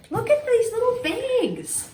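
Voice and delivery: high, loud delight; excited. Real, not acted. Showing viewers cute bags, excited and high. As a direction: gush at the little bags.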